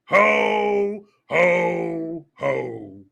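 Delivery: long booming ho's stepping down in pitch; hammed-up Santa, each ho held; mock Santa laugh. Santa to the kid, drawn-out mock belly laugh. Movie Santa after the quote.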